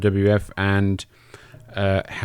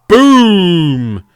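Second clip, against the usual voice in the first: sudden loud boom, much higher; big shout; 7 semitones higher, 10 dB louder than usual. Pretend. Watching the belly splash, the fan bellows the impact. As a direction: bellow it like a ringside announcer calling the slam.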